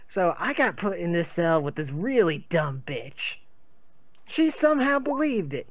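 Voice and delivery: rising, excited yarn; excited tall tale. Acted. Character tells ferret legend excitedly.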